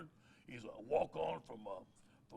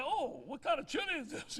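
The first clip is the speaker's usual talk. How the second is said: higher, mock-curious voice; curious question; 11 semitones higher than usual. Coach asking the mom about her chili, mock curious. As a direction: act politely curious about the bowl. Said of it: "I said".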